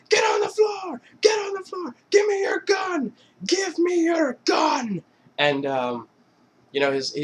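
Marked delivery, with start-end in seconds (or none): 0.1-5.0 high, loud barked orders; mock gangster shout. Brothers fake-ambush their friend, yelling high orders. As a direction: yell the commands like a raid. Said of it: "they're like".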